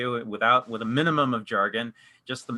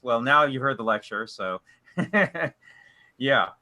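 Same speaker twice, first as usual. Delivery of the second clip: ordinary talk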